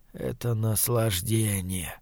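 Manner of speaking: very soft, slow, low murmur; whispering. A narrator's reading. Dying man tells her of killers' pleasure, whispering. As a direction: draw it out in a hushed voice. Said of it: "прошептал он".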